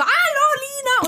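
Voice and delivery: high, slow, drawn-out hello; exuberant greeting. Retold or imitated. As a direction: call out a wildly cheerful hello. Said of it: "von wegen so".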